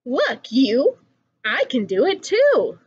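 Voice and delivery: usual speaking voice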